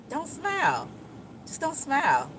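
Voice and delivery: high, soft self-coaching, holding back a smile; telling oneself not to smile. Acted. Catching someone looking, high soft self-warning. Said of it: "You be like".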